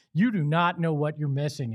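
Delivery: ordinary talk, nothing marked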